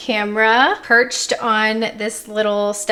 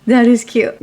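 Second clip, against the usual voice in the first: ordinary delivery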